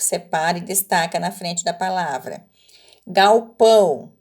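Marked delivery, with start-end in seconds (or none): 3.1-4.1 "Galpão" said slowly and loudly, nasal ending held; teacher stressing the nasal "ão" in "Galpão"; slow clear word. Shown as a demonstration. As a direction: draw out "Galpão" for the class. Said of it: "aonde que está o encontro vocálico".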